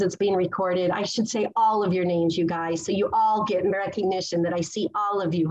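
normal talking voice

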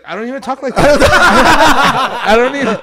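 high, loud protest through laughter; laughing disbelief. Real, not acted. Man baffled by his own starstruck talk, laughing loudly. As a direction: protest helplessly, cracking up.

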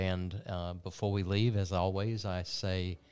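upbeat TV host wrapping up the hour; show sign-off. Acted. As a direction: close the broadcast like a TV host.

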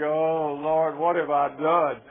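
loud, slow comic lament; mock regret. Overstuffed man in bed to the Lord, mock remorseful groan.

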